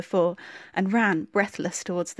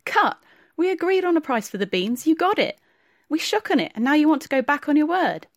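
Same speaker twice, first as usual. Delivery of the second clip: much higher and louder, incredulous and furious; indignant outrage; 8 semitones higher, 4 dB louder than usual. Acted. Timur outraged at Tufan breaking their deal, indignant shouting. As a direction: throw the accusation back in his face. Timur answers accusation, quarrel.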